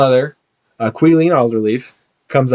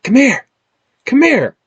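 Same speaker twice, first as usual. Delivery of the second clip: put-on high, loud eager kid's call; 12 semitones higher, 5 dB louder than usual. Young boy calling the party over, high and eager. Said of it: "and he's like".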